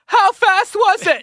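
very high, slow, drawn-out question; hammed-up eagerness. Hammed-up prompt on request.

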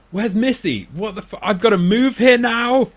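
high, loud imitation of bursting in; mock shouting. Retold character bursting in, demanding.

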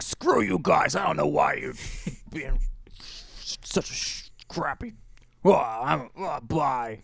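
a lightly voiced pouting vampire; sulky blame. Vampire player sulking at the group, lightly voiced. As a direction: storm off blaming everyone. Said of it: "Jake Arrieta's like".

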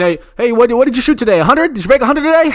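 high, teasing mock voice; mocking impression. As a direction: mimic him walking in, needling. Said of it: "you walk in".

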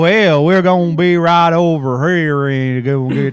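imitating a fake Southern accent from nowhere real; mock Southern accent. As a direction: ham up a made-up Southern accent, slowly. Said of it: "he does this weird Southern accent".

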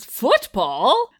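light imitation of his "Football?"; lightly mimicked, incredulous-sounding question; imitating a film line. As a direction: quote it like a favorite movie line, swooping up questioningly. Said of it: "obsessed with the way he says".

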